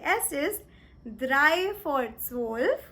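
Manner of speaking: very slow, careful German; "drei vor zwölf" stretched out slowly; slow German demo. Shown as a demonstration. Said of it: "You can simply say".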